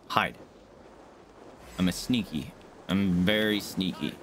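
low, slow sneaky voice. Pretend. Gamer hiding from the boss, low sneaky voice. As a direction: play the sly sneak. Playful self-talk hiding.

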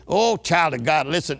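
normal talking voice

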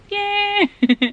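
high happy yell; excited cheer. Real, not acted. Kid cheering at pickup, high and happy.

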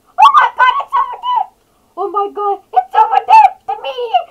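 very high, loud frantic shrieks; panicked screaming. Real, not acted. Player spotting the meteor over there, panicked scream. Spots meteor falling in game.

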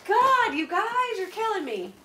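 overwhelmed. Real, not acted. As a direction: draw it out, overcome by kindness. Overwhelmed by generosity.

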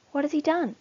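ordinary delivery, nothing marked

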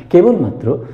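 ordinary talk

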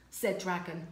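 ordinary delivery

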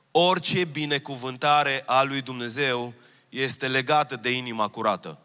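ordinary talk

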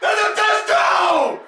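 very high, full-voiced yelling; real drawn-out outraged hollers; furious fan shouting. Real, not acted. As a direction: scream at the referees on TV.

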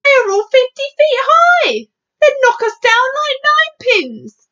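high, loud military outburst; exclaimed warning of disaster; alarmed protest. Acted.

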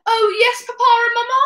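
put-on obedient little boy. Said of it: "I was like".

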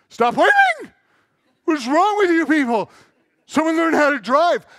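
high, loud mock road rage; exasperated comic shouting from behind the wheel; moody driver's rant. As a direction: yell at other drivers, hammed up. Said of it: "turn to the darker, moody side".

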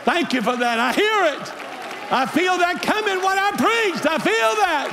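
high, hollered revival shout; full-force preaching. Real, not acted. Preacher answering a roused congregation, shouting. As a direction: shout it out, stretching the repeated words.

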